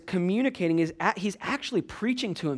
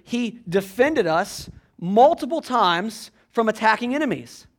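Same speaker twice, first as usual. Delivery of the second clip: higher, louder preaching run; building preaching; 5 semitones higher, 5 dB louder than usual. Acted. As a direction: build the list of God's deeds with rising fervour.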